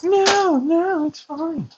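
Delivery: high, sing-song mocking quote. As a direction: mimic them in a high squeak. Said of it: "They're all like".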